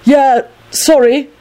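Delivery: high, slow call upstairs. A narrator's reading. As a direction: call it up to someone above. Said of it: "Neville called back up".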